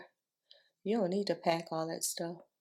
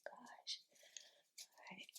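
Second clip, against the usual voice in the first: whispering; 23 dB quieter than usual. Real, not acted. Muttering while fixing her hair.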